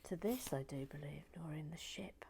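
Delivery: soft unvoiced whisper while building. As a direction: breathe the words, barely voiced.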